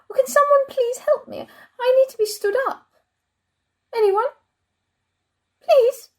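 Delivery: high, pleading calls into emptiness; anxious calling out. Acted. Forgotten chair calling for anybody to help, anxious pleading. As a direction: call for help, pitched well above normal.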